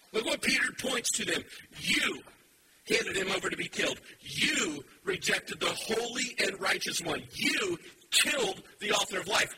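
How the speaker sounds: loud, rising preacher's call; fiery sermon voice; full-force preaching. Acted. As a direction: drive it home to the congregation.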